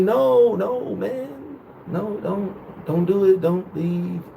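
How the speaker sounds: high, fading fake whimper; mock pleading. Dumped boyfriend asking her to stay, mock pleading. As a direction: beg feebly, hamming up the heartbreak. Mock begging as she breaks up.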